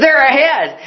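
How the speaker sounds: put-on high, upbeat kid's answer; cheerful boy, pitched well up. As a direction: lightly play an unbothered young ballplayer. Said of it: "the boy was so cheerful and encouraging".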